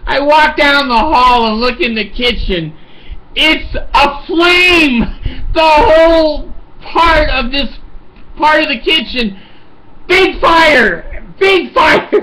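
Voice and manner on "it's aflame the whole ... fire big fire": high, panicked cries of alarm. Retold or imitated. Old man spotting fire in his kitchen, panicked high cry. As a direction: shout it like you've just seen flames.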